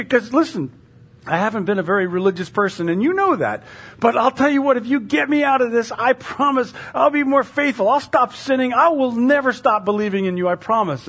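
high, urgent acted pleading; desperate bargaining.

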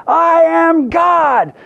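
long, high, loud mock proclamation; drawn-out shout to the whole beach, hammed up; Shirley MacLaine shouting. Actress shouting on the beach at guru's bidding, mock shout. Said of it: "Shout out, 'I am God.'".

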